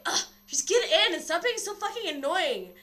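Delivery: loud, high mock exasperation; retold irritated outburst; exasperated. Acts exasperated doctor in story.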